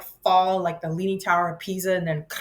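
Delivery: ordinary talk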